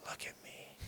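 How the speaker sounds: hushed whisper, savoring the moment; whispered self-congratulation. Retold or imitated. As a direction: whisper it to yourself, quietly pleased. Retold smug thought to himself.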